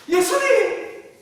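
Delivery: long Gyeongsang-dialect call, high and loud. Retold or imitated. Disciple waking Jesus in the storm, Gyeongsang-dialect call. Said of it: "경상도시로 부르고".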